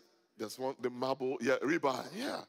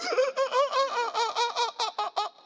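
very high, loud pulsing laugh; exaggerated staccato laugh; 8 dB louder than usual. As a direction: burst into long, high, hammed-up cackling. Remembers covenant restrictions.

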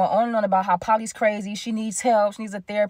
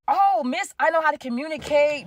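high, sarcastic mimicry; mocking; 6 semitones higher than usual. Speaker mocking girlfriend's claimed maturity, high sarcasm. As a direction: sneer her claims back at her in a higher pitch.

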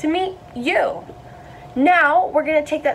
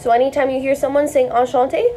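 normal talking voice

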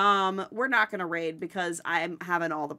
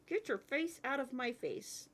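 higher, softer cute dog voice; voicing the dog; 4 semitones higher, 9 dB quieter than usual. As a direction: say it as a sweet little pup. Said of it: "He says".

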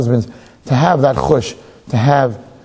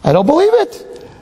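high, thrilled gasp; amazed the neighbour's wash is clean; delighted surprise; slower than usual. Acted. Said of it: "she says".

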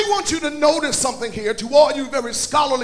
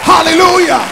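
loud, sung-out preaching over the organ; full-force preaching; 2 semitones higher, 9 dB louder than usual. Acted. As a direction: preach it out with fire to the church.

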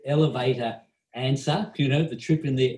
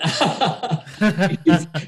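short real laugh burst; amused laugh; 5 semitones higher, 5 dB louder than usual. Real, not acted. Laughing at the stupid question.

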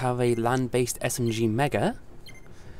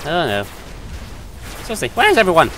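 scared giddy; 4 semitones higher, faster than usual. Real, not acted. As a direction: call out for missing teammates. Team vanished, calling out.

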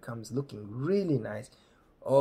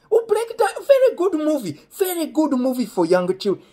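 very high, loud mock enthusiasm; imitating delighted dad; 14 semitones higher, 10 dB louder than usual. Friend's dad approving the movie, hammed-up loud delight. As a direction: exclaim it as a high-pitched approving father.

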